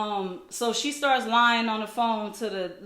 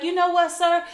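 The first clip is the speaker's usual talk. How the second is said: raised, higher voice of a mimicked busybody; indignant intervention; 5 semitones higher, 6 dB louder than usual. Woman sends the man off, imitated indignant intervention. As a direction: imitate a woman loudly taking his side.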